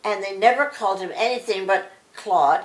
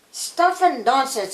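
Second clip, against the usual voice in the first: scornful father; 6 semitones higher, 4 dB louder than usual. A narrator's reading. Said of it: "said the father".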